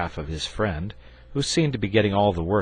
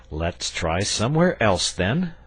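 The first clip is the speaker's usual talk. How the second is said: patient; 5 semitones higher, 6 dB louder than usual. A narrator's reading. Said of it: "said Saint George patiently".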